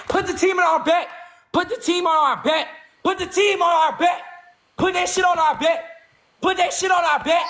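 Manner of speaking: rally everyone with a shout; hyped shout. Real, not acted. Streamer rallying teammates to put the team on the bet, shouting.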